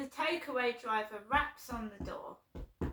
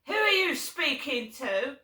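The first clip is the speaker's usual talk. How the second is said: louder, mock confrontational demand; retold angry challenge; 3 semitones higher, 8 dB louder than usual. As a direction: snap it like an interrogating father. Dad confronting her on phone.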